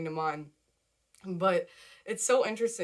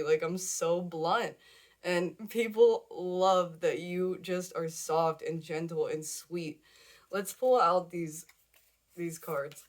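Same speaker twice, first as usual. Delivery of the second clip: ordinary talk, nothing marked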